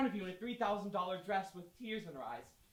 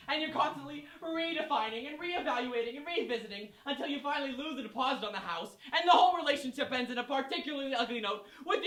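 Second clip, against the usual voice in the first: rising louder and higher until a shout; building rant to shout; 7 semitones higher, 18 dB louder than usual. Real, not acted. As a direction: let the frustration boil over into a shout.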